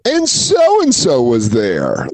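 drawn-out mock amazement; hammed-up awe, very slow; blown away. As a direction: stretch every word as a stunned reveal. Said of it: "Like, they're blown away".

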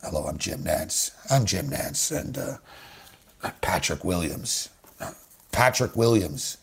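very low, hushed, slow golf commentary; soft, deep, unhurried broadcast hush; Jim Nantz golf voice. Acted. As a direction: murmur it like a golf announcer by the green. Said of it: "I'll go into a golf voice here".

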